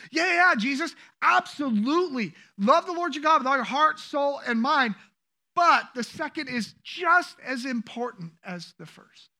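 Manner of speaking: preacher acting out an emphatic answer; acted emphatic agreement. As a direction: agree forcefully, voice lifted.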